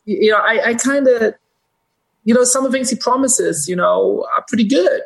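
high, hammed-up voice of a working-class Jersey guy; Jersey comedian's guy. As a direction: pitch it way up as the comedian's everyman. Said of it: "I'm not trying to do the accent".

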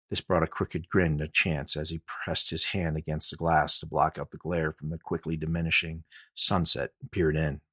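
ordinary delivery

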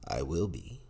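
sly, hushed and a little playful; mischievous. A narrator's reading. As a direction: say it with a hidden grin. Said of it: "said the fiend in a mischievous way".

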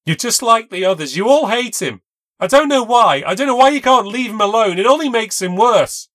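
loud, high furious accusation; angry outburst. A narrator's reading. Kayla turning on her friend, loud angry accusation. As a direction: lash out, hurt and angry. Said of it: "gets angry with me".

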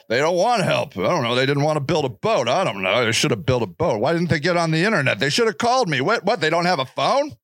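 low, dismissive mock of the complacent; mock smug voice. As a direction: sneer like a know-it-all neighbour. Mocking people who blame homeless.

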